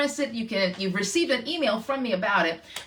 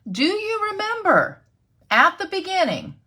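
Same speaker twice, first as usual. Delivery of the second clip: excited question; 9 semitones higher, 6 dB louder than usual. Real, not acted. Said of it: "repeats the question".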